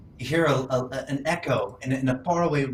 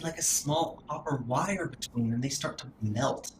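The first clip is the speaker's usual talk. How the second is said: normal talking voice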